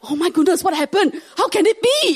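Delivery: high, laughing mock amazement; stunned agent. Agent, jaw dropped, in high laughing mock amazement. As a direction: gasp it out in disbelief. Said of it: "she's like".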